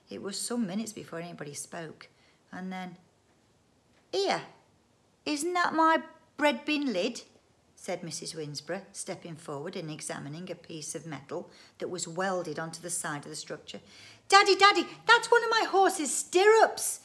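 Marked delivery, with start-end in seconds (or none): 4.1-7.2 high, loud questioning wife; indignant surprise. Acted. Wife spots her lid on the machine, high surprised query. 14.3-17.0 put-on very high, loud girl's wail; child's wail. A narrator's reading. Daughter spots her stirrup on dad's machine, wailing. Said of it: "wailed Karen".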